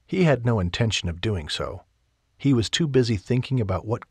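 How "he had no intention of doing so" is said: normal talking voice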